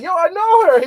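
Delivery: excited yo; eager insistence. Retold or imitated. Telling the clerk excitedly.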